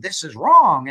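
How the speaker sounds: loud, sharp protest; retold shout at the front desk; angry outburst. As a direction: raise your voice at the desk clerk. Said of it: "I scared everyone at the front desk".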